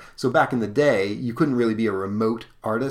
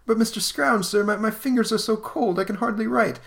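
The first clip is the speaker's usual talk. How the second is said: much higher meek plea; timid clerk; 12 semitones higher than usual. Acted. Cold clerk begs his stingy boss, high meek whimper.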